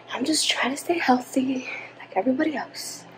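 soft whispered aside; whispering. Real, not acted. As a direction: drop to a whisper. Unvoiced aside after shower plan.

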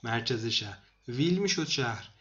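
usual speaking voice

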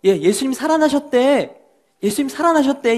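high, excited spreading of news; can't wait to tell everyone; excited rumor. Acted. People passing the news, thrilled. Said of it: "또 사람들이요".

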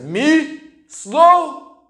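high, loud offended disbelief; indignant bear. A narrator's reading. Bear offended at being called slow, loud and high. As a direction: bear can't believe he's called slow. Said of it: "said the bear".

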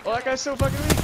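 loud, high-pitched gloating; excited shout. Real, not acted. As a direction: crow over the weak enemy. Mid-fight excitement.

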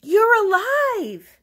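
high, loud, drawn-out cry; overjoyed scream of discovery; joyful shriek. A narrator's reading. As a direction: shriek it slowly over applause. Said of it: "I shrieked over the applause".

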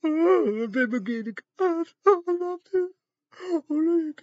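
high, drawn-out mock sob; mock crying. Mocking empathy for the dead, hammed-up crying. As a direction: wail "I love you" in fake tears.

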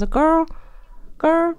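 put-on "girl" drawn out with a high rising lilt; imitating friend's "girl". Mimicking her friend saying "girl" with a sweet high lilt. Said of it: "She says".

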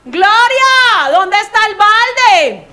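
shouting high and loud across the room, slow; loud, high, drawn-out yell; loud call. Retold or imitated. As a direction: call out loudly for Gloria from afar. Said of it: "el señor dice".

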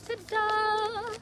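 ordinary talk, nothing marked

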